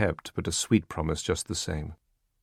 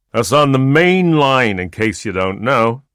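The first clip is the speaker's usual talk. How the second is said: put-on drunk boaster; 6 semitones higher, 10 dB louder than usual. Drunk husband boasting at dinner.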